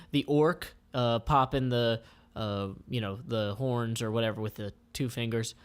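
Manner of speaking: ordinary delivery, nothing marked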